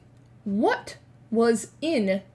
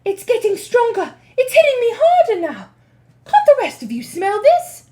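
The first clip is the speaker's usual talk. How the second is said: excited witch; 13 semitones higher, 10 dB louder than usual. Acted. Mildred insists excitedly.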